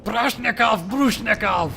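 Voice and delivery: high, loud yell imitating a German woman; loud, high-pitched imitated German outburst; mock German yell. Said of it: "she yelled something along the lines of".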